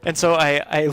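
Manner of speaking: usual speaking voice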